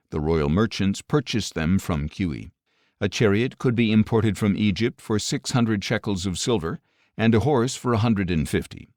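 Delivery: ordinary talk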